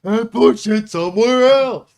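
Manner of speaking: loud, high mock-heroic yell; playful shout. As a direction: belt it out as a silly battle cry.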